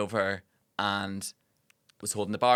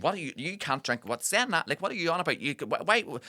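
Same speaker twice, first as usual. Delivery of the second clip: imitating a nagging mum, pitched up; mum piping up; 6 semitones higher than usual. Mum to diner about the drink, piping up. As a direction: pipe up in quick, broken objections, higher voice. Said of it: "mum's then piping up".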